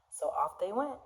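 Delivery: plain reading voice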